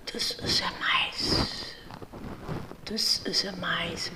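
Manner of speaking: soft unvoiced whisper; hushed whisper about the mouse. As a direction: whisper it like a secret to the kids. Whispered aside showing mouse.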